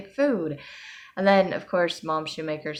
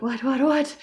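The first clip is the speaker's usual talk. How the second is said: higher, louder quick whats; flustered louder repeated what; startled what; 10 semitones higher, 6 dB louder than usual. Retold or imitated. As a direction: snap round at your name. People calling her name.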